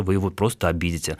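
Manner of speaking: usual speaking voice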